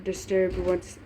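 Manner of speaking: plain reading voice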